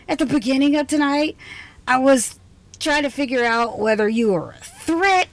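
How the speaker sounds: normal talking voice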